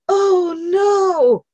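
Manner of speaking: loud, high "oh no"; acted alarm. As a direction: cry out to halt yourself. Acting out catching herself grieving.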